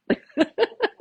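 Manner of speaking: bursting high laugh; loud high-pitched real laugh. Real, not acted. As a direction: laugh out loud at the patient's line. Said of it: "And I was laughing".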